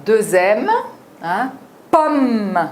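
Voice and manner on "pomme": « pomme » plus haut, M pressé; M double accéléré. Shown as a demonstration. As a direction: dire « pomme » avec une accélération sur le M. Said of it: "une petite accélération". (« pomme » avec un M rapide)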